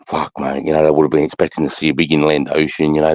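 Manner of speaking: ordinary delivery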